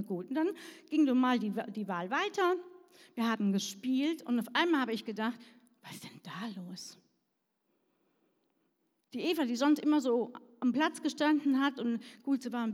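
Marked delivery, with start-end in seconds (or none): 5.8-7.0 whispered in surprise; astonished whisper. Retold or imitated. Preacher recalls seeing shy Eva suddenly play, whispered. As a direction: breathe it in amazement. Surprised thought during game.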